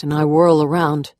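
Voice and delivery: ordinary delivery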